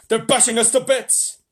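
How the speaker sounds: shouting astronaut. A narrator's reading. Shuckworth radios the president in panic, loud. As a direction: yell it over the din. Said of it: "Shuckworth was shouting".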